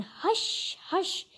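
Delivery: breathy hush, then slow creeping words. A narrator's reading. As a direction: tiptoe past the bear with your voice. Sneaking past a sleeping bear.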